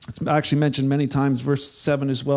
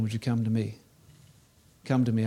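much softer and lower, gentle plea; tender invitation; 5 semitones lower, 5 dB quieter, faster than usual. Acted. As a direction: invite them quietly, tenderly.

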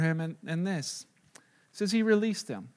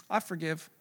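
hushed, lowered answer; calm forgiveness; 2 semitones lower, 10 dB quieter than usual. Acted. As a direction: let it go quietly. Calm answer to being wronged.

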